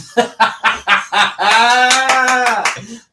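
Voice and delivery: a big real burst of laughter; loud laugh. Real, not acted. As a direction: laugh out loud, caught off guard. Real outburst at a rude chat name.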